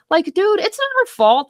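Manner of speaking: dude, come on, she did nothing wrong; exasperated outburst. Real, not acted. Defending the wife to the listener, exasperated and high.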